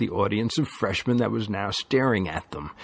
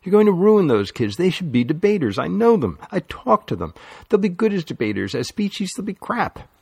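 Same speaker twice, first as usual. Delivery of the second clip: much higher, heated protest; angry plea; 8 semitones higher than usual. A narrator's reading. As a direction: defend those kids passionately. Lily pleading angrily for the kids.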